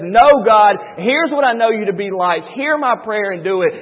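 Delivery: loud, earnest plea to God; bold plea. Retold or imitated. Pastor voicing Moses begging God, loud and earnest. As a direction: cry out firmly, refusing to back down.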